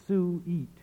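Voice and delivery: "su-eat" split into two syllables; two-syllable sweet. Retold or imitated. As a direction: stretch sweet apart into "su-eat", falling at the end. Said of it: "She diphthongizes the vowel of sweet".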